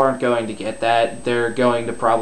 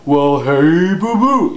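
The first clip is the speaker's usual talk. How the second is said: loud cartoon bear call, swooping up high at the end; Yogi Bear; 5 semitones higher, 5 dB louder, slower than usual. Shown as a demonstration. Coach showing students how the throat feels, Yogi Bear voice. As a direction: hail your little pal in a big false voice. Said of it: "let's take Yogi the Bear".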